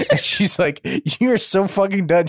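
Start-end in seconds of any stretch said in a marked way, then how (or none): 1.2-2.3 raised, higher mock fury; mock angry outburst. Retelling the heroine dumping the guy, mock angry. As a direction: snap at him like she's fed up. Retold woman telling him off.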